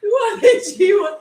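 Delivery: cracking up while yelling; laughing. Real, not acted. Streamer yelling at the ref through laughter, laughing.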